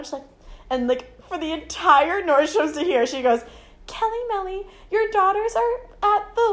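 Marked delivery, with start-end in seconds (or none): 3.9-6.5 high, singled-out PA call; store announcement. Retold or imitated. Store lady on speakerphone paging mom, mock announcer voice. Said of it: "she grabs the speakerphone".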